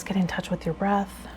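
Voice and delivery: usual speaking voice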